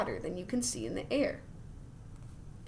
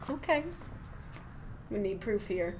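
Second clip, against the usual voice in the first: ordinary talk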